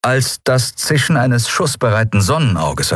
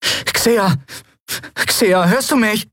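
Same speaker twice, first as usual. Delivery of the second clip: urgent calling; slower than usual. A narrator's reading. Miko calling frozen friend.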